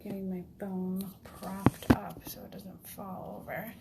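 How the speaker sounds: hushed whisper, hiding away; whispering while hiding. Real, not acted. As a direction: whisper so nobody hears. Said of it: "Yes, I'm hiding in the bathroom".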